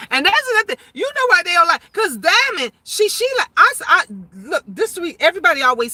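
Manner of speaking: high-pitched, worked-up real outburst; unwitting yelling. Real, not acted. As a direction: raise your voice high without realizing it. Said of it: "Sometimes I yell and I don't know I'm yelling".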